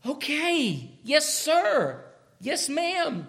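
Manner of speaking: put-on respectful child agreeing at once; lightly voiced dutiful child; eager obedient child. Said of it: "those who are righteous will say".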